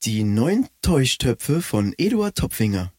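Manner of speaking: slow, announcer-style slogan. Acted. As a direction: read it like a commercial tagline. Ad slogan read.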